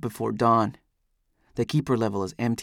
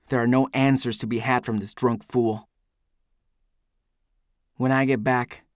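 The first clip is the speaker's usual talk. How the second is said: ordinary delivery, nothing marked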